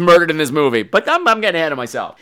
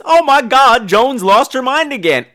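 mock alarm; 6 semitones higher, 3 dB louder than usual. Mock horror in retelling.

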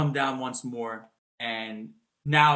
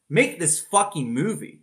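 higher, louder, drawn-out plea; mock demand; 5 semitones higher, 6 dB louder, slower than usual. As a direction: demand it loudly, stretching every word. Pleading for a sequel, friend laughs.

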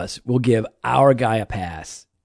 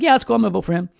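breezy mock approval; 7 semitones higher, much faster than usual. Voices everybody shrugging off the scandal.

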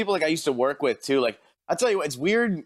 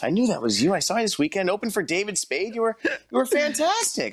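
excited fan; 5 semitones higher than usual. Retold or imitated. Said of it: "And she's like".

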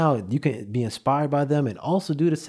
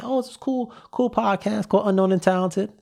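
high, bright-eyed hype for the show; pitched-up excited plug; enthusiastic recommendation; 8 semitones higher than usual. Retold or imitated. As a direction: sell it like you just discovered it.

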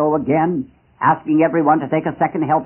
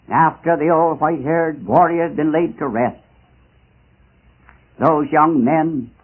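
usual speaking voice

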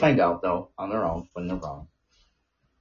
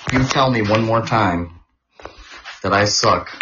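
louder, slow mock warning; daring him to say it once more; mock threat; 6 dB louder, slower than usual. A loud, slow playful threat to the friend.

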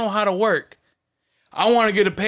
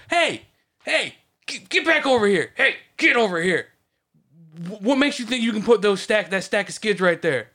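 yelling at coworker; 3 semitones higher than usual. Shown as a demonstration. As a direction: bark it across the warehouse. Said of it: "that should get the message across".

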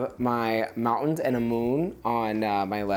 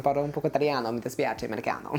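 put-on American accent; 3 semitones higher, much faster than usual. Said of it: "I'm American".

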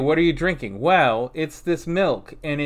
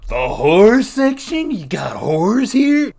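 spooky voice; 7 semitones higher, 9 dB louder than usual. Acted. Spooky mock dread.

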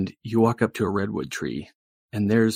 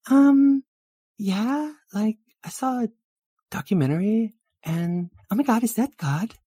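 put-on very high, girly nervous awe; high-pitched flustered onlooker; awed valley girl; 13 semitones higher, 5 dB louder than usual. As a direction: ask timidly in a high girlish voice.

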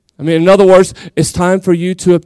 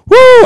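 high loud celebratory whoop; preacher's excited high whoop; 8 dB louder than usual. Real, not acted. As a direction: let out a joyful church whoop. Preacher celebrates after point.

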